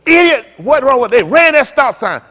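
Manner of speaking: a sudden loud bark of "Idiot!"; mock road rage yell. Driver yelling at a sign runner, loud.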